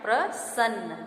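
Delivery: प्रसन्न in two parts with a clear s, "pra-sann"; hiss-free of sh, say प्रसन्न as "pra-sann"; correct pronunciation. Shown as a demonstration. Teacher giving students the correct form, clear s. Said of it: "शुद्ध रूप से हमारा है".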